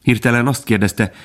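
usual speaking voice

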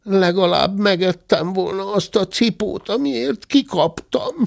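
high, plaintive wail; high-pitched childish lament; whining cub. A narrator's reading. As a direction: whine high like a hard-done-by little bear. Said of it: "siránkozott mellette Zebike".

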